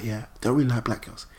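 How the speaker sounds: soft, low undertone; imitated low whisper. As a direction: mimic someone keeping a remark private. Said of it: "he was proper whispering".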